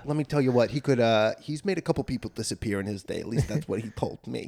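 soft-spoken mock wiseguy, confiding; wannabe mobster kid. Comic mimicking a classmate's mob brag in a soft tough-guy voice. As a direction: play a kid bragging quietly about mob ties. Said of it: "a kid who talks like that".

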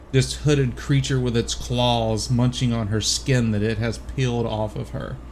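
ordinary talk, nothing marked

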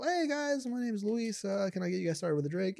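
high, bright server greeting; waiter voice. Retold or imitated. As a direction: play the eager waiter. Waiter greeting role-played.

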